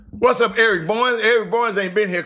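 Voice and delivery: loud shout-out to a viewer; loud greeting. Real, not acted. Calling out a viewer.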